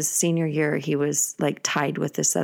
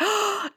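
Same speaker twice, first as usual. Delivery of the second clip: gasp. Retold or imitated. Hearing brother sing.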